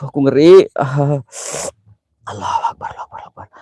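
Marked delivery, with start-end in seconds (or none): 2.3-3.5 hushed, breathless Allahu Akbar; whispered prayer. Real, not acted. Takut kesurupan, berdoa pelan. (doa berbisik ketakutan)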